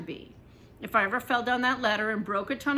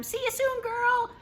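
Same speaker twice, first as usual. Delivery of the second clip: high called goodbye, much higher; calling out; 11 semitones higher, 4 dB louder than usual. A narrator's reading. Girl calls goodbye to her pet spider, high call. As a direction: sing it out to the spider while running past. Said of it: "I called".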